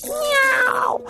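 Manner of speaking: high-pitched meow; cat meow. Acted. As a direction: give a short high miaow. Cat narrator meows.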